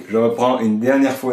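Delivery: normal talking voice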